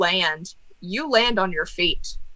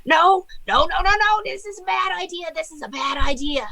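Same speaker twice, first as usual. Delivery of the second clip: panicked book in the Joe Pesci voice; 9 semitones higher than usual. Acted. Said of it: "It's just like".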